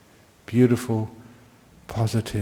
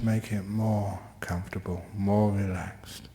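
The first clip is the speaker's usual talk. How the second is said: guided meditation; 3 dB quieter than usual. Real, not acted. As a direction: ease listeners into stillness gently. Leads the class into meditation.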